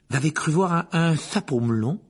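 usual speaking voice

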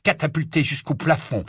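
ordinary delivery, nothing marked

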